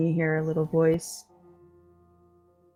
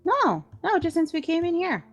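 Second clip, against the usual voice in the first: much higher tiny pixie voice; 12 semitones higher than usual. Acted. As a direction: play a small pixie, pitched way up. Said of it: "you hear a little voice".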